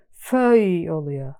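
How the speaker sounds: ordinary talk, nothing marked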